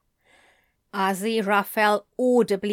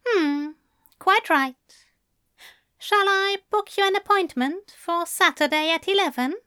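Aziraphale voice; 8 semitones higher than usual. A narrator's reading.

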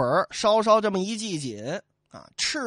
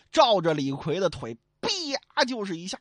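plain reading voice

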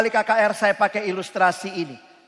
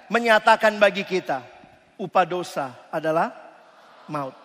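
ordinary delivery

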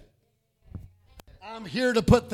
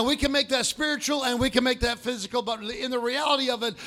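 ordinary talk

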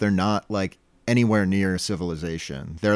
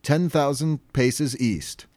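slow, raised, dramatic announcement; grand captain proclamation; 6 semitones higher, 3 dB louder than usual. Retold or imitated. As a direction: proclaim it like a treasure-hunting captain. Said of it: "Mister Krabs remarks".

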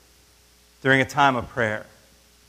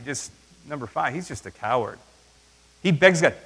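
ordinary talk

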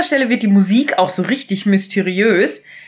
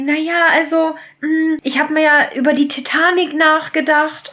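hesitant maid; 6 semitones higher than usual. Retold or imitated. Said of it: "dann sagt sie".

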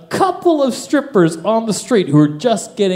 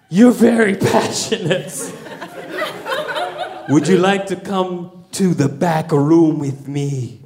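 a hint of a put-on Russian stripper; lightly voiced seductress's invitation; imitating Russian stripper; 3 semitones lower than usual. Russian stripper invites customer to back room, lightly imitated. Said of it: "she's like".

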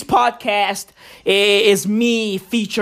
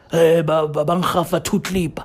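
lightly voiced low gibberish answer; mock a worker's low, garbled reply; worker's mumbled reply; 6 semitones lower than usual.

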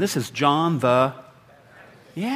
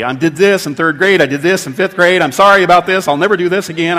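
loud, rushed babble of confessions; hammed-up guilty outburst; panicked confessing; 2 semitones higher, 9 dB louder than usual. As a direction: blurt out every sin at once. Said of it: "we're gonna start confessing immediately".

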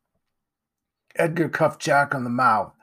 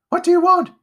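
very high, loud panicked shout; shrieking scared boy; frightened cry; 10 dB louder, faster than usual. A narrator's reading. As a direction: yell at the unseen thing in terror. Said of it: "he cried".

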